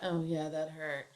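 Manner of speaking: deadpan, no force at all; casual mumbled "that hurt"; flat and offhand. Shown as a demonstration. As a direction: say it like nothing happened, flat. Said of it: "you usually aren't gonna just say that".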